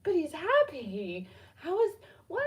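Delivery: whiny, bewildered comeback; baffled protest. Retold or imitated. Answering her friend about the happy dog, baffled protest. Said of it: "And I'm like".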